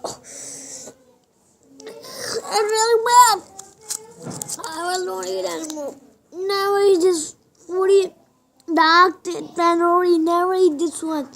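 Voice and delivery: loud, high disgusted complaint. Real, not acted. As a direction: spit out the words in disgust. Disgust at sour chocolate.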